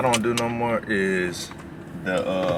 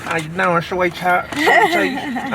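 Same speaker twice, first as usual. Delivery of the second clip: put-on old man voice; 9 semitones higher, 6 dB louder, much slower than usual. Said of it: "like, old man voice".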